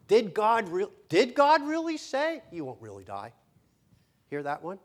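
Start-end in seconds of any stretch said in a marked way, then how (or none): 0.1-3.3 much higher, louder sly taunt; the serpent sneering to plant doubt in God's word; mocking serpent. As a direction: tempt them with a sneering question. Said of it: "Satan wants you to think".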